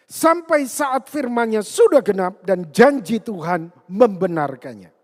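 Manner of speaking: loud, forceful Bible declamation; ringing pulpit reading of a psalm; preaching scripture reading. Acted. Reads out the psalm passage.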